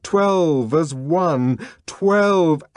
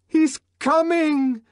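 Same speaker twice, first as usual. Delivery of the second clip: high ecstatic shout; excited shout; 3 dB louder than usual. Acted. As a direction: shout it out in frenzy. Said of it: "shouted Sarojini Engels".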